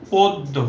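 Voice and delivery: পদ্ম said as "পদ্দো", doubled d, ending in o; model pronunciation. Shown as a demonstration. Teacher answers own question, models পদ্ম with final o. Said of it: "পদ্ম কিভাবে উচ্চারণ হয়?".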